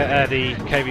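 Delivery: ordinary delivery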